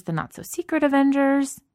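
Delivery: high, ringing end of the list; excited. Acted. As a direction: climax the list with a big high lift on Avengers. Kate listing Avengers teams, worked up.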